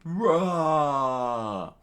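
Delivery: long, loud cheering yell; high drawn-out war cry; crowd's roar. Acted. Crowd answering their king's rant, roaring scream. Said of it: "the crowd screamed".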